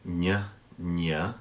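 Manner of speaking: ñ with tongue held up on the roof of the mouth; palatal ñ. Shown as a demonstration. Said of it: "Your tongue has to stay up".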